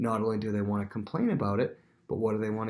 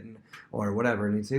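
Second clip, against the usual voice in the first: ordinary talk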